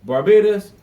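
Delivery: calls out the country's name loudly, like a bank addressing the whole island; a bank calling out to Barbados to get everyone's attention; mock public address. Bank addressing the nation, loud call. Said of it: "come out first thing on Monday morning and say".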